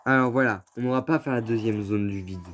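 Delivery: ordinary delivery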